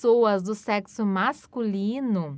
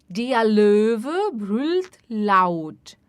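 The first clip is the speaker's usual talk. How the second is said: very slow, louder and clear; slow model sentence; 4 dB louder, much slower than usual. Shown as a demonstration. Teacher modelling sentence slowly for repetition. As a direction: stretch each word for learners. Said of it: "teacher models sentence".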